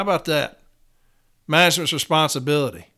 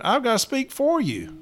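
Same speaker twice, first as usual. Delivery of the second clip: sneering mock quote, pitched higher; mocking coward quote; 9 semitones higher than usual. Union host mocking a cowardly official's excuse, sneering and higher. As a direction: lift the voice way up to mock him.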